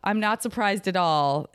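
ordinary delivery

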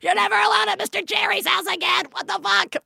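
high, shouting mom scolding; mock yell. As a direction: yell like a shocked parent laying down the law. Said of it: "I'm like".

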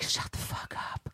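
slow, hushed scolding; angry whisper. Retold or imitated. Parent hushing son mid-musical, angry whisper. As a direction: whisper it slowly, furious but hushed. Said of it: "this whole whispered, angry conversation".

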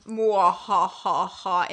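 slow evil villain laugh; slow theatrical villain menace. Acted. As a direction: cackle like a cartoon villain. Spooky reading ends with evil laugh.

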